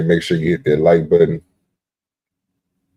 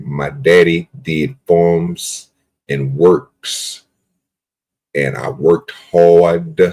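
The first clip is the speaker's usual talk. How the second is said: very slow drawl, vowels held long; Forrest Gump drawl; much slower than usual. Retold or imitated. Streamer teaching his Forrest Gump, long held Southern drawl. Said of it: "You gotta hold it".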